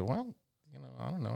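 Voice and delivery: put-on shy hesitant teen, trailing off; mock hesitant; hesitant mumble.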